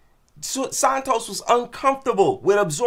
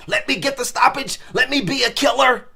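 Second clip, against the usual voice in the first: mock gung-ho fighter; 2 semitones higher, faster than usual. Mocking reckless fighter's mindset.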